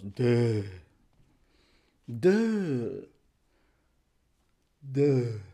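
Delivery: long, dopey "duh" as a tease; mocking duh. Shown as a demonstration. Teacher imitates making fun of someone, mocking duh. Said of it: "ganas de burlarnos de alguien".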